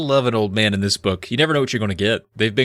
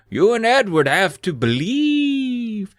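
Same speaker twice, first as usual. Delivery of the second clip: put-on slow, high British old man; Morgred; 11 semitones higher, slower than usual. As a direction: stretch the last word as a theatrical elder. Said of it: "Morgred replied".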